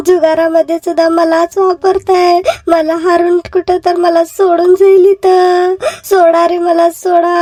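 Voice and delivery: tearful wife wailing about her luckless marriage; wife lamenting. Acted. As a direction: cry out to God like a wronged wife. Wife laments being wagered away.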